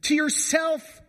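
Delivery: high, slow, crying plea of a frantic mother; distraught mom crying out. Acted. Mother crying out to her teenage son, high anguished wail. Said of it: "it's the mom crying out".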